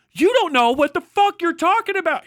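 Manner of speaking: real rage at a sloppy journalist; angry outburst. Real, not acted. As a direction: snap at the writer in real anger. Streamer ranting at article author.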